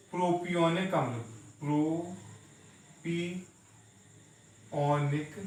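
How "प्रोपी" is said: slow, drawn-out प्रोपी- split off as its own chunk; syllable by syllable. Shown as a demonstration. Teacher to students, slowly sounding out प्रोपी-. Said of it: "इसका pronunciation रहेगा प्रोपी".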